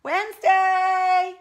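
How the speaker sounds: drawn-out loud high call, slow; long call. Real, not acted. Owner calling the sleeping dog, loud and drawn out. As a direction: stretch her name out to wake her.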